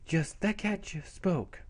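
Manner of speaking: put-on high, slow stunned girl voice; acted astonishment. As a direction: marvel slowly at a talking cat. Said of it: "She goes".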